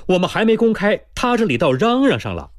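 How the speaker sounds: high-pitched, agitated character line; agitated complaint. A narrator's reading. Character's startled grumble, voiced in story.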